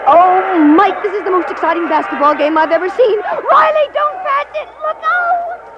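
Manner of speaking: high, loud, breathless cheering at the game; thrilled cheering. Acted. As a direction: cheer on your team, totally caught up. Thrilled fan watching close game.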